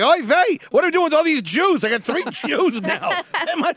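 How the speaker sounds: hammed-up Yiddish kvetching parent; Yiddish accent.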